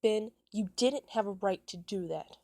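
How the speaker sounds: loud, indignant telling-off, short of a real scream; narrated scream. A narrator's reading. Jeff scolds Ben for what he did, half-screamed. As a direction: raise your voice at Ben in mild outrage. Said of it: "Jeff screamed".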